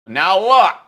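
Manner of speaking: high, loud mock yell; mimicked shout. Mimics the clip just played.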